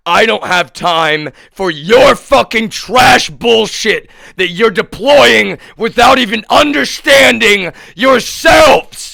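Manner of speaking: raging tirade; furious shout. Real, not acted. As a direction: scream it at them. Angry rant at hackers.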